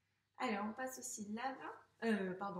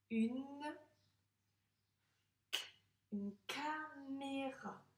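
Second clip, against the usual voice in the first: "une caméra" stretched very slowly, syllable by syllable, higher; slow sounding out; 4 semitones higher, much slower than usual. Shown as a demonstration. Teacher slowly sounding out "une caméra" for the child. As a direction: draw out "caméra" as if reading it for the first time. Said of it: "Une caméra, c'est dont on se sert".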